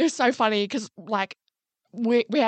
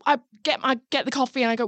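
normal talking voice

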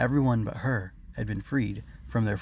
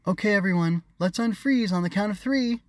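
high, loud call to everyone; 10 semitones higher, 4 dB louder than usual. A narrator's reading. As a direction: call out to the crowd. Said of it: "Harmony called to her subjects".

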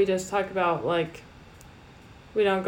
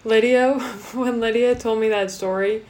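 ordinary talk

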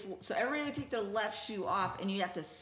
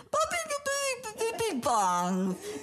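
holding tongue; 11 semitones higher, 3 dB louder than usual. Shown as a demonstration. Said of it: "I gotta hold my tongue".

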